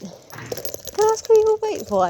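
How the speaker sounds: high, slow put-on voice for the guard; high mock official voice; security guard voice.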